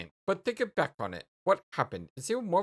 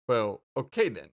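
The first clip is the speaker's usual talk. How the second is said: normal talking voice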